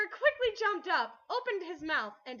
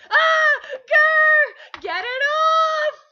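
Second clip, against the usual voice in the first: shrieking high and loud, slowing on the last words; desperate yelling; panicked screaming; 11 semitones higher, 17 dB louder, much slower than usual. Acted. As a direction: scream for help as it claws your face. Squirrel attacks Zim's face.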